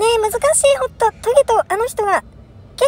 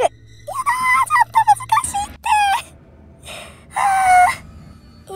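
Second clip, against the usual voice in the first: long high wailing cry; hit hard crying out; 13 semitones higher, much slower than usual. Real, not acted. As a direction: shriek of dismay. Gamer caught, panics.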